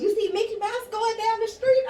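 high, goofy stoned husband. Retold or imitated. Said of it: "So he goes".

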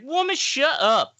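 high, slow mock-irritated outburst; exasperated scolding. Storyteller as Derek breaking the silence, exasperated scolding. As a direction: imitate a fed-up man, drawn out and high. Retold Derek snapping at woman.

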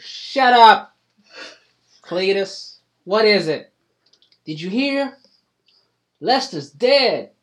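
high, loud hillbilly woman snapping back; a farmer's wife yelling back at her husband; country wife. Acted. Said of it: "she goes".

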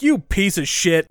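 hammed-up teen telling him off, slow and loud; mock angry insult. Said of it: "She's like".

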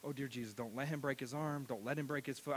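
hushed, worried little prayer; anxious prayer. Retold or imitated. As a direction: mutter a nervous prayer under the breath. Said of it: "I've watched him do stuff going".

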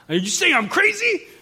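high, loud, offended question; indignant outburst. Retold or imitated. Hurt brother challenging his sister, indignant outburst. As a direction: shoot up into an incredulous shout.

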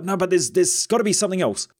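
lightly voicing a suspicious friend; mock worried friend.

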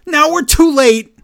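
high, loud panicked imitation; hammed-up frantic yelp; mock freak-out. Recapper acting out a wrestler losing it, mock panic. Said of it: "And he freaks out".

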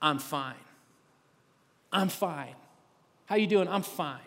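soft, low, flat-out automatic I'm fine; muttered I'm fine. Retold or imitated. Everyone telling the world they're fine, soft and low. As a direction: brush it off softly, on autopilot. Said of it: "we say that one four-letter word".

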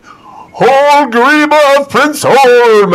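high, loud, very slow booming herald call; pompous herald announcement. Retold or imitated. Herald announces Holg's arrival at the party in a weird accent. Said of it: "like this weird accent that comes out".

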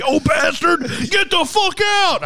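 high, loud mock yell; pretend outburst. Man picturing himself yelling in the store, high mock yell. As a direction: blow up like a cranky customer. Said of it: "I didn't wanna be".

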